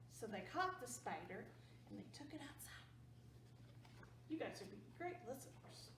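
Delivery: ordinary delivery, nothing marked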